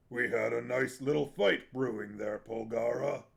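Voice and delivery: a big man sulking over a lost fight; complaining big warrior. Acted. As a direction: moan like a disappointed brawler. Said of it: "Barak complained".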